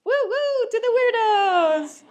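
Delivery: high, loud whoop to the weirdos; rally the crowd with a happy whoop; a big high cheer for the crowd. Real, not acted.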